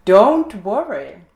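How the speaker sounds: slow, loud reassuring alligator; a sly alligator calming the others, drawn out. A narrator's reading. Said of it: "said the alligator".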